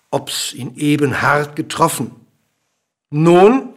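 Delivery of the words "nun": loud, high rising question; calling out. A narrator's reading. King checking on the fallen dwarf, calling out. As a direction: call one word out expectantly. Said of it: "rief der König".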